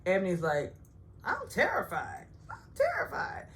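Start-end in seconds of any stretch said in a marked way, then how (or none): 1.2-2.3 higher, put-on timid voice; mock scared. Ebony's fear mocked. 2.5-3.5 very high, breathy fake cry; mock sobbing terror. Ebony's fear mocked, second take.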